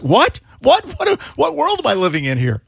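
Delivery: high, incredulous repeated what; mock disbelief. Retelling a text fight, baffled mock disbelief.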